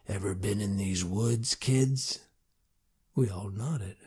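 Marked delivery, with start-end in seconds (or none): 0.1-2.2 put-on a lightly grumbly old lawman, a touch lower; dry, grumbly marshal. A narrator's reading. Marshal grumbling at the kids, lightly lower and dry. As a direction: grumble it low like a thirsty bear. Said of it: "his voice dry and grumbly like a thirsty bear".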